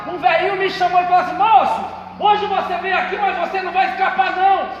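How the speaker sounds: loud, ringing pulpit testimony with music; preaching at full force. Acted. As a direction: preach it out to the whole church. (pregação inflamada em voz alta)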